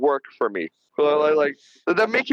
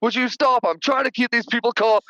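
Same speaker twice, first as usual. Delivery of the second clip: higher-pitched exasperated scolding; mock yelling; 6 semitones higher than usual. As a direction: imitate a fed-up warden, volume held back. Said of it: "he's yelling at her".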